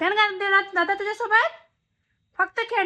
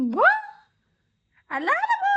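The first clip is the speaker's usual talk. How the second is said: put-on playful baby talk; 12 semitones higher than usual. Peekaboo game with baby.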